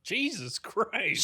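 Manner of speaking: slow, drawn-out stunned exclamation; a pal gasps at hearing a car landed on his friend; shocked. Real, not acted. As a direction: let the shock stretch the words out. Reacting to being hit by car.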